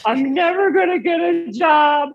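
high, whiny imitation of crying; mock sobbing boyfriend. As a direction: ham up his tearful despair. Boyfriend retold crying, broken finger.

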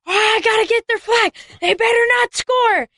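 high, fired-up yell; urgent chasing cry. Retold or imitated. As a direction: shout it like you're chasing down the flag. Acting out chasing the ball carrier.

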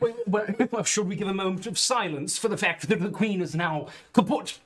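put-on over-the-top posh British accent; posh English accent. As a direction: play a pompous Englishman. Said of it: "very over the top".

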